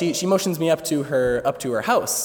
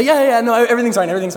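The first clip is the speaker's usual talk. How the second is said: high, loud hurried denial; flustered reassurance; 7 semitones higher, 5 dB louder, much faster than usual. Retold or imitated. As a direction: insist too fast that all is fine. Flustered reply from the bathroom.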